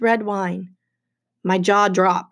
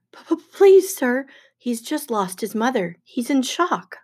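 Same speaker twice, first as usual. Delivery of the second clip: stammering, higher-pitched plea; nervous pleading; 4 semitones higher than usual. Acted. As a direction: stumble into begging a feared boss for mercy. Grover nervously pleads for Percy.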